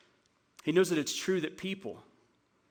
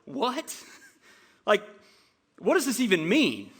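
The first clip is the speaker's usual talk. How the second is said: baffled reaction; 4 semitones higher, 3 dB louder than usual. Retold or imitated. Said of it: "you're like".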